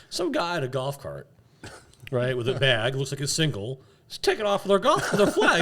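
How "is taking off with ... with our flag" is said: laughing through the words; giggling, voice pitched up. Real, not acted. Laughing while telling story.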